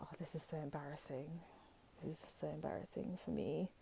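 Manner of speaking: soft, embarrassed, under the breath; embarrassed murmur. Pretend. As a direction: mutter it as if mortified in public. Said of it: "And you guys are just gonna be like".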